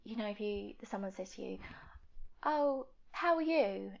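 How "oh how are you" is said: slow, bright sing-song how are you; mock cheery greeting. Asking a friend brightly, mock cheery. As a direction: sound breezy and polite.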